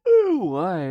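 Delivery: yawning mid-word; stretched yawn through words. Real, not acted. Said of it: "I always yawn when I'm talking".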